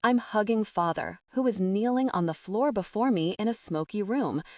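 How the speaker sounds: ordinary delivery, nothing marked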